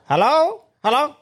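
high, hammed-up Indian call-centre voice; mock Indian accent. Mate imitating the Indian scam caller, high mock accent. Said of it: "this fucking Indian bloke".

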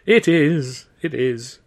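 loud tearful wail, fading. Acted. Wife wails to Arthur that it's her fault, loud tearful wail. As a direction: cry it out, then sink back. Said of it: "she wailed".

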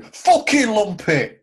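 high, slow, bellowed terrace yell; angry fan shout. Retold or imitated. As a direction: bellow it like a furious fan in the stands. Mimicking a fan at Town.

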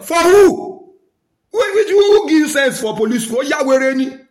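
loud, high-pitched angry challenge; indignant outburst. Real, not acted. Challenging police who order people around, indignant outburst. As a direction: fire the questions out, high and loud.

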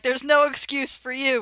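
loud schoolyard taunt, imitated; mocking Misfits retort. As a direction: throw it back as a mock comeback. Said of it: "the Misfits are like".

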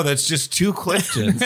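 ordinary delivery

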